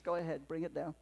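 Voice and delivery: normal talking voice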